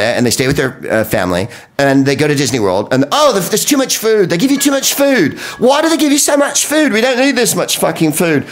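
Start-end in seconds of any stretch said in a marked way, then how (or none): 3.1-8.5 high, fussy whining English tourist; very high mock English tourist whine. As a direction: complain about too much food. English tourists complaining about food.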